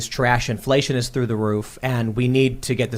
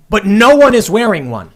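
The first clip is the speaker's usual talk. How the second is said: raised, louder retold protest; exasperated protest; 6 semitones higher, 7 dB louder than usual. To the waiter, an incredulous louder protest. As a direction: push back, louder and higher, in disbelief. Arguing with waiter about masks.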